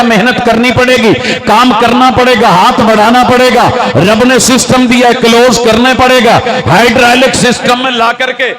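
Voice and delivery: fiery preaching. Acted. Preacher urges the faithful to work for their fate, fiery. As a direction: preach it hard, hammering every line.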